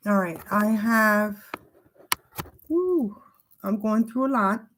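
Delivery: fighting tears, breaking on the exhale; holding back tears. Real, not acted. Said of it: "me crying all the time".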